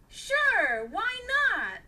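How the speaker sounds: eager, high and loud; excited. A narrator's reading. Dylan accepting Isaac's adventure invite, excited. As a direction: burst out with eager yes. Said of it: "excitedly replied Dylan".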